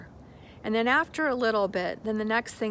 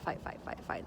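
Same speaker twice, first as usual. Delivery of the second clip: trails off into a whisper; fading whisper; 10 dB quieter, faster than usual. Shown as a demonstration. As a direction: let the repeated fight die away to breath. Repeated fight, fading to whisper.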